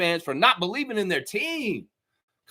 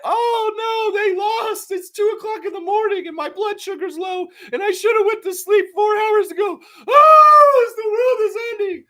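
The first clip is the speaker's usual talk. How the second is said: high, wailing mock panic; panicking fan; 13 semitones higher, 6 dB louder than usual. Mocking a sleepless fan at two in the morning, wailing panic. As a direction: whine like the sky is falling. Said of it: "people will get".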